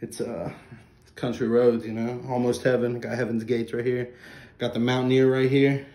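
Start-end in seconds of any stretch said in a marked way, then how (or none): none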